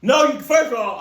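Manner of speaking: sharp, loud, high refusal; outraged yell, real. Real, not acted. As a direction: shout no at the creep. Reacting to creepy stalker note.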